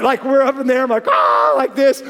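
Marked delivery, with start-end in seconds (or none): 1.1-1.5 a loud excited yell in the air. Retold or imitated. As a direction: yell as you fly over. Airborne on the wake.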